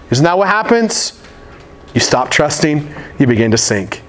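normal talking voice

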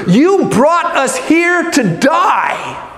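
slow, drawn-out whiny accusation; hammed-up grumbling cry; mock complaining shout. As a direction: hurl it back as a bitter complaint. Israelites complaining to Moses.

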